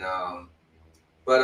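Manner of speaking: normal talking voice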